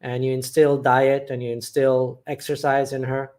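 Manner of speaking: usual speaking voice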